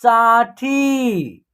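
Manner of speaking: साठी drawn out slowly as one word; blended syllables. Shown as a demonstration. Teacher showing learners how it reads together, साठी slowly blended. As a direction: join the syllables of साठी, holding each long vowel. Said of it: "तो एक साथ उच्चारण करेंगे".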